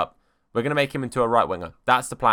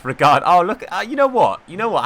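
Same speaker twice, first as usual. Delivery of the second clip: excited outburst; 7 semitones higher, 8 dB louder than usual. Real, not acted. Sudden outburst at orange lines.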